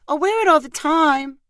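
put-on high little-girl begging; pleading little girl. A narrator's reading. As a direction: promise it eagerly as a small child, way up high. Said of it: "copied her brother and added".

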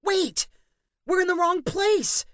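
high, loud panicked cry; alarmed cry. A narrator's reading. Danny realizing they came out in Michael's, alarmed cry. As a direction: shout out the mistake in alarm. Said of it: "he cried".

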